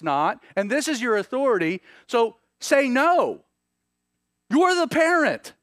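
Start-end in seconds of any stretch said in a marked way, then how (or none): 2.1-3.4 slow and emphatic, every word pressed; firm slow no. Acted. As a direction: lay down the rule slowly. Parent urged to refuse child.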